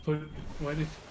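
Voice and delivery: normal talking voice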